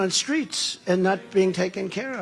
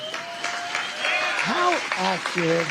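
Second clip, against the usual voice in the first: high, very slow "How accurate" with a stretched "how"; drawn-out how accurate; 9 semitones higher, much slower than usual. Shown as a demonstration. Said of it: "How accurate is that?".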